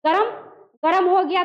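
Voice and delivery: ordinary talk, nothing marked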